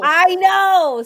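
loud, high, heartfelt exclamation; emphatic agreement. Real, not acted. Storyteller told she should follow through, emphatic agreement. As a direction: burst out in rueful agreement. Agreeing loudly at own blunder.

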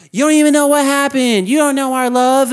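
high, loud mock wailing protest; hysterical girlfriend imitation. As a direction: act out a girl yelling in her man's defense. Voicing girl defending her boyfriend.

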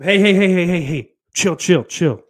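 loud, high rapid-fire interruption; mock-alarmed protest. Streamer stopping a chatter's risque message, loud mock alarm.

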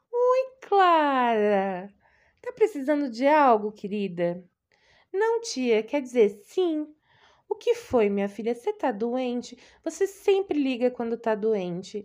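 loud, high-pitched caring aunt; doting aunt. Acted. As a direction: gush over her like an anxious old aunt. Voices the fussy aunt Doroti.

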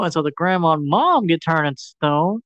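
ordinary talk, nothing marked

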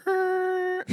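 high, held harmonica drone; imitated harmonica. Telling friends how trucks with glued harmonicas sound, imitated harmonica. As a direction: honk one long high note like a harmonica.

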